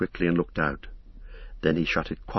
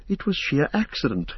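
higher-pitched interrupting protest; 9 semitones higher than usual. A narrator's reading. Frodo breaks in to explain the prank, protesting. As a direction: cut in, pitched well up. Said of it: "interrupted Frodo".

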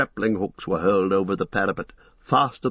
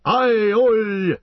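high dwarf battle shout; 12 semitones higher, 4 dB louder than usual. A narrator's reading. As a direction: shout it across the fighting. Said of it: "he shouted".

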